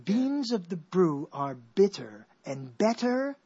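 slow, careful alliteration; slow tongue twister. Shown as a demonstration. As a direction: savour each B word. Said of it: "a tongue twister".

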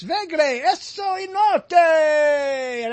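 high, loud, slow nonsense proclamation; mock foreign shout. Host teasing listeners with a mock foreign slogan. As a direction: bellow fake foreign words slowly and grandly. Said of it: "Would you like a translation of what I said?".

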